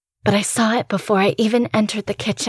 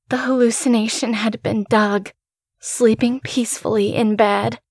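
ordinary delivery

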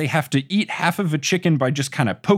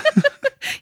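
high laugh. Real, not acted. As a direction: burst of laughter, much higher. Amused by the quoted line.

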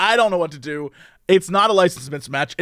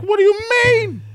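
much higher, mock-outraged question; incredulous what; 14 semitones higher than usual. Driver imagining outrage at the attendant, much higher and incredulous. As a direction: squeak out the disbelief.

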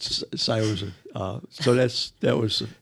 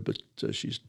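hushed sadness; 10 dB quieter than usual. Real, not acted. Wife's stroke, voice drops.

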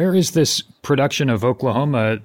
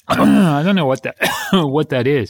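coughing mid-sentence. Pretend. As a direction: cough breaks the name. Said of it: "Choking Every Other Word".

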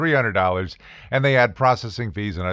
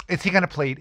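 high, put-on nagging voice; impatient prosecutor; 8 semitones higher, faster than usual. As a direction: badger him like a bored, pushy prosecutor. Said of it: "and of course the same attitude".

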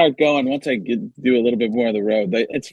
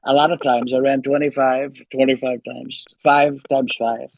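Donald Trump. Acted. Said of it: "back to Trump".

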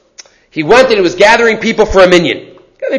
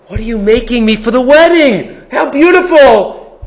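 put-on bright, delighted girl's voice, higher, slowing on "How beautiful"; excited daughter; 4 semitones higher than usual. Tailor's daughter thrilled at the wedding dress, delighted. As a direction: gush over a new dress.

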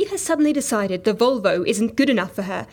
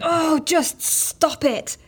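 exasperated; 4 semitones higher, much slower than usual. Acted. Fed up with pirate talk.

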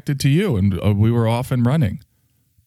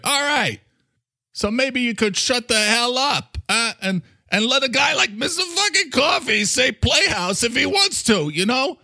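high, badgering New York character; brash New Yorker demanding an answer; Mister Coffee; 13 semitones higher than usual. New Yorker grilling International about his work, high and pushy.

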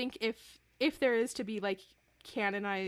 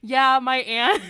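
laughing; 5 semitones higher, 13 dB louder, slower than usual. Real, not acted. Quoting Silvestri while laughing.

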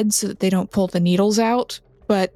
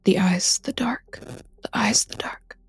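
frightened breathless whisper, fading away; patient whispering the same words over and over; muttered whisper; 5 dB quieter than usual. Acted. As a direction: whisper it like a man in shock. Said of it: "you just hear him muttering".

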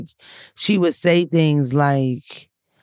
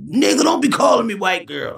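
mock shout, higher; playing the offended one, a mock yell; angry retort; 4 semitones higher than usual. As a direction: bark it back like you're offended. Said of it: "but it's not like".

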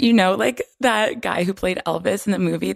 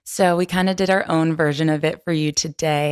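ordinary talk

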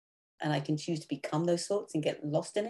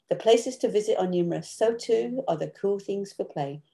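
higher, louder recital voice; poem reading; 3 semitones higher, 4 dB louder than usual. Acted. Guest reading her poem to the host, recital style. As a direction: read the poem with lift and rhythm.

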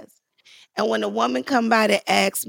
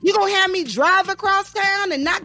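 high, indignant mock tirade, rising in pitch; mad outburst; 7 semitones higher, faster than usual. Auditioner threatens to tell on the casting room, mad. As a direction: rattle off threats to report them all. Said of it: "I got so mad".

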